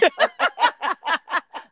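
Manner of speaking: high, helpless cackle; big squealing laugh; loud laughing fit. Real, not acted. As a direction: burst out in high-pitched laughter. Laughing at name on list.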